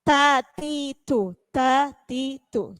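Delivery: tatitu said slowly with a slight breath on each t; light aspirated t. Shown as a demonstration. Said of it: "ada hamesnya sedikit ketika pengucapannya huruf ta". (tatitu pelan dengan sedikit hembusan pada huruf ta)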